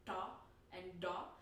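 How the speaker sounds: "ta and da" with tongue curled back; Indian-style retroflex "ta and da"; retroflex Indian sound. Shown as a demonstration. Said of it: "typically Indian sound".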